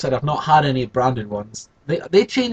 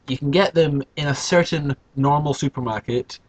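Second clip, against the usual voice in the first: normal talking voice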